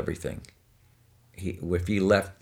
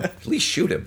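angel, higher; 11 semitones higher than usual. Retold or imitated. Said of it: "The angel's going".

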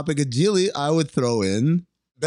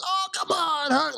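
movie character impression; 13 semitones higher than usual. Pleading with Herc in the movie, high put-on voice. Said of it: "But in the movie, he goes".

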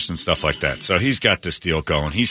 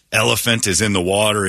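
ordinary talk, nothing marked

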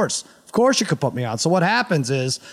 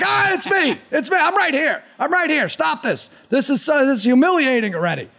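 embarrassed shouting; 9 semitones higher than usual. Retold or imitated. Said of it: "I'm like".

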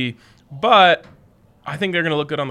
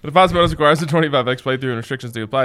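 fast legal disclaimer. Acted. Sponsor ad read.

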